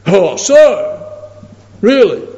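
high, loud disbelieving question; can't believe what you hear; imitated scoffing atheist; incredulous.

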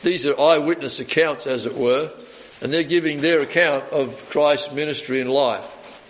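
normal talking voice

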